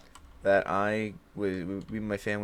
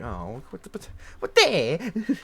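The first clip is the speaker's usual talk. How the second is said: mock bewildered kid; 6 semitones higher, 4 dB louder than usual. Kid scolded over scissors, high baffled mock confusion. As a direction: act dumbfounded, like you don't get the fuss.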